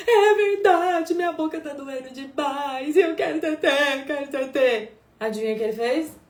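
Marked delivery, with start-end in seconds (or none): 0.1-4.9 put-on high, slow, whiny little child voice; milk the pain like a crafty toddler; whiny fake pain. Mother imitating her daughter's fake mouth pain to get milk.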